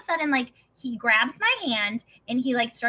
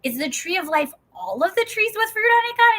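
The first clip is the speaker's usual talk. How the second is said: incredulous; 9 semitones higher, 4 dB louder than usual. Retold or imitated. Incredulous question to God retold.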